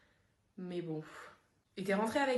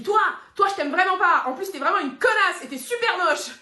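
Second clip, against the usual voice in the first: loud, high insult; shouted insult; 6 semitones higher, 8 dB louder than usual. Acted. Friend yelling insults straight at her. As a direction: yell it at her face.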